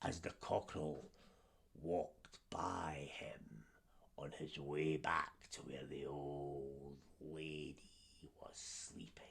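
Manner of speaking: hushed storyteller whisper; low suspenseful whisper. A narrator's reading. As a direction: breathe it like a secret.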